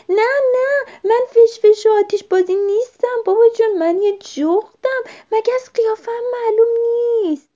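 high, loud flustered denial; frantic protest. A narrator's reading. Owlet mistaken for a firework by boy, frantic loud protest. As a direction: protest urgently while flapping to stay up.

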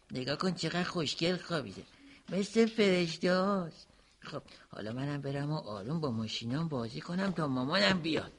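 put-on soft, low voice beside a sleeping baby; softly hushed, tiptoeing voice. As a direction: keep it down so he won't wake. Boy whispers beside sleeping brother.

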